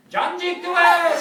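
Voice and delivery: long, high shout to a far-off villager; calling out. Retold or imitated. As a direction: holler it down the road, drawn out. Calling to villager from car window.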